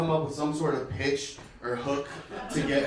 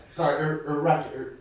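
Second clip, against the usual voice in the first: ordinary talk, nothing marked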